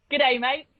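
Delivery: a light joking attempt at an Australian accent. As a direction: play the cliché Aussie tourist-ad line in an Australian accent. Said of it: "I do do an Australian one".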